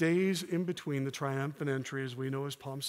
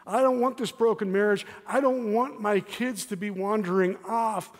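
complaining to God; 7 semitones higher, 8 dB louder than usual. Acted. Voicing a believer's complaint to God.

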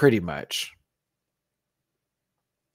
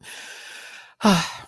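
long airy exhale; big sigh; 10 semitones higher than usual. Real, not acted. Host wrapping up the stream, big sigh.

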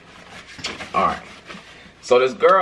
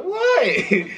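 laughing innocent what. Retold or imitated. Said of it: "I'm like".

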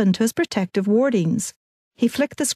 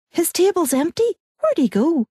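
put-on much higher, breathless girlish voice; eager fangirl; 9 semitones higher than usual. Fangirls bursting into the pub, eager and high. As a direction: chase him down like an excited fan. Shrieking fangirls hunt for Mac.

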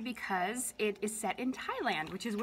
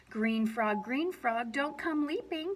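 ordinary delivery, nothing marked

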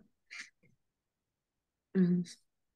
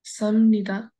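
Samnida said a bit more firmly; louder on request; 4 dB louder, much slower than usual. Shown as a demonstration. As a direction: raise your voice on Samnida. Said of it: "Say it little bit loudly. Increase your voice".

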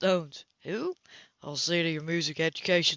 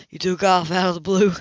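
loud accusation; 10 dB louder than usual. Acted. Cam accusing.

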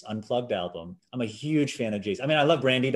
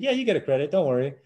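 reassuring; 5 semitones higher, 4 dB louder, faster than usual. Retold or imitated. Producer reassures him.

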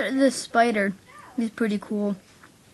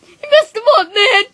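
high, loud upset complaint; 9 semitones higher, 10 dB louder than usual. Acted. About the horse wrecking his flowers, an upset outcry. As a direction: shout it, outraged at the mess.

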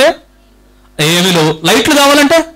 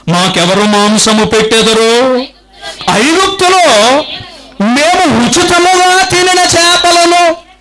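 slow scripture quote; 4 semitones higher, slower than usual. Shown as a demonstration. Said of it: "scripture quote read".

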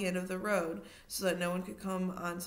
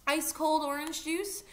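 Penny offering juice; 8 semitones higher, 6 dB louder than usual. A narrator's reading.

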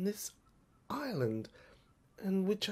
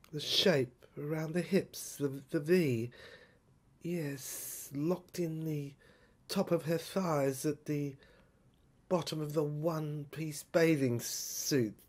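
ordinary delivery

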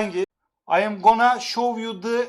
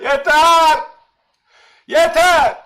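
yeter called out loud and sharp; firm shout; 6 semitones higher, 7 dB louder, much slower than usual. Real, not acted. Streamer telling his chat to stop, loud and firm.

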